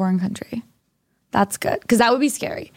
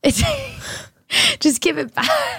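laughing plea; slower than usual. Real, not acted. Pleading with a passport thief.

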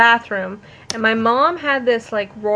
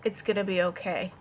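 low, soft reassurance; calming dad; 4 semitones lower, 9 dB quieter than usual. Retold or imitated. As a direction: imitate a dad soothing an upset child. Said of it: "John was talking to me like".

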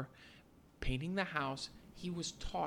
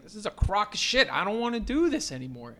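fed-up Daniel; 6 semitones higher, 8 dB louder than usual. Retold or imitated. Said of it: "And he's like".

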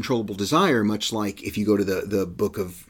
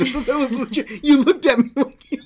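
laughing; 12 semitones higher, 4 dB louder than usual. Real, not acted. Laughing at own misunderstanding.

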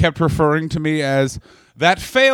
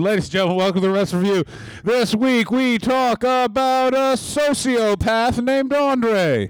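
dramatic announcer; 8 semitones higher than usual. Acted. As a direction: build it up like a movie trailer. Mock show intro.

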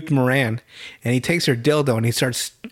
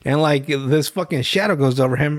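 ordinary delivery

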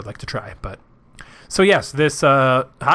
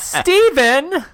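laughing mock scold; 12 semitones higher, 4 dB louder than usual. Real, not acted. Scolding partner after joke.

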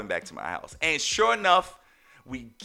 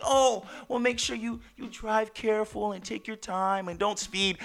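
higher, softer, overly sweet caution; obvious sister; 8 semitones higher, 5 dB quieter than usual. Retold or imitated. Sister hinting too obviously on the phone, mock sweet. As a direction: ham up a too-obvious hint. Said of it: "she was just being extremely obvious".

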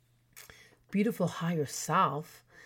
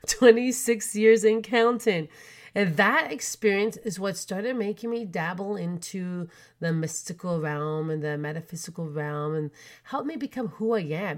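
ordinary talk, nothing marked

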